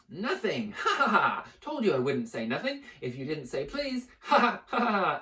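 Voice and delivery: high, loud gloating laugh; mocking cackle. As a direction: laugh in his face. Peeves mocking Filch with laughter.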